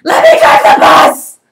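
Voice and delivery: high, very loud, slow tantrum yell; pigeon's all-out tantrum at the reader; angry shout. Acted. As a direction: scream it like a furious bird. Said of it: "That poor pigeon is so angry".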